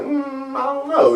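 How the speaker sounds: high, drawn-out hedging; hesitant reply. Retold or imitated. Retold hesitant reply to suggestion.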